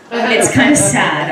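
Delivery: usual speaking voice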